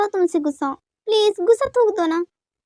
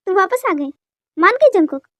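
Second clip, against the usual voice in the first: ordinary delivery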